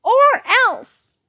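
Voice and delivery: loud, high bratty shout; full high-pitched tantrum yell. A narrator's reading. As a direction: yell it like a spoiled child making demands. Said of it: "yelled Ivy".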